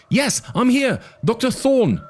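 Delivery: put-on high, urgent kid's voice; a frightened boy pleading over the radio. A narrator's reading. Arby urges Thorn and Malcolm into the car, high and urgent.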